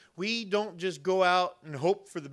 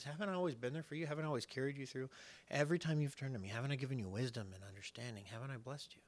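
soft, quiet reassurance; hushed questions, fading quieter at the end; gentle reminder as God; 2 semitones lower, 10 dB quieter, faster than usual. Acted. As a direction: softly remind them with rhetorical questions. Voices God reassuring the frustrated.

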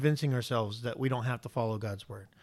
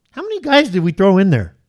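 high, loud, incredulous questioning; acted bewildered king; 4 semitones higher, 9 dB louder than usual. Preacher playing Nebuchadnezzar seeing into the fire, loudly bewildered. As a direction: blurt it out in baffled disbelief.

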